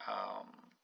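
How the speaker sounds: normal talking voice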